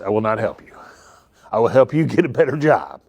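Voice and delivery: ordinary talk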